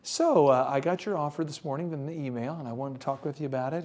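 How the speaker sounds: calm and composed, as if fully re-energized; Joel Osteen. Retold or imitated. Negotiator back to the other party next day, serenely calm. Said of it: "go into my inner Joel Osteen moment".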